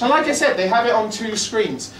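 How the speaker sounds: ordinary talk, nothing marked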